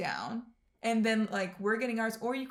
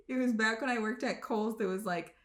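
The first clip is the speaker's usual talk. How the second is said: usual speaking voice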